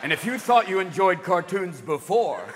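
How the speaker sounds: low, official announcer voice; mock solemn public-service announcement; warning label read. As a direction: read it like a deadpan government warning. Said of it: "It's gonna have to say".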